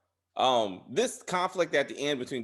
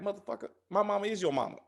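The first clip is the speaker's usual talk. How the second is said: fast street comeback; fast tough comeback; 7 semitones higher, faster than usual. Retold or imitated. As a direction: snap it back quickly. Imagined comeback.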